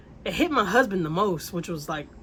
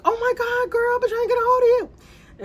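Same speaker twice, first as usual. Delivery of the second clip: much higher and louder, a squealing greeting; mock drunk screaming; 7 dB louder than usual. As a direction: squeal it like a tipsy friend spotting you. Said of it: "And she starts screaming".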